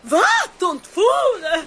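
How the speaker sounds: shocked, outraged disbelief; shocked outcry. Acted. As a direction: gasp it out in horror. Accused of kidnapping children.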